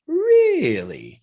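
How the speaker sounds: very high, drawn-out retold question; amazed disbelief. Coach hearing she is ready, exaggerated surprise. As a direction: stretch it high, then let it drop. Coach reacts to her announcement.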